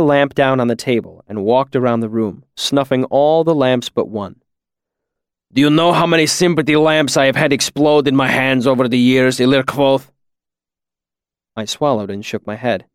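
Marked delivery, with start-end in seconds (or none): 5.5-10.1 put-on heavy deliberate accented voice; Kilvin, stern master. Master quizzing student on exploded lamps, stern accented challenge. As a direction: lecture like an old-world craftsman testing a pupil.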